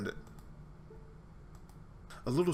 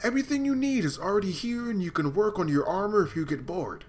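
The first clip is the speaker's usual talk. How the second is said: louder, higher motherly scolding; 5 semitones higher, 4 dB louder than usual. Acted. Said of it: "I sound like a mother scolding a particularly dim-witted child".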